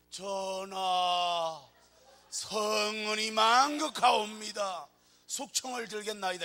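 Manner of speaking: loud, slow, drawn-out court servant voice; courtly servant. Pretend. Wife answering husband like a court servant, loud and slow. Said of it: "이래야지".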